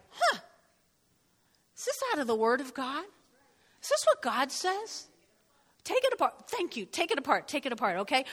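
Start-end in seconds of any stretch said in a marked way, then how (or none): 0.1-5.1 interrogating. Shown as a demonstration. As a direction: question the thought like a detective. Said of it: "interrogate it like".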